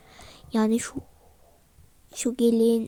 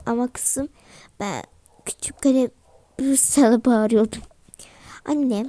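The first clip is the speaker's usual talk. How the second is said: normal talking voice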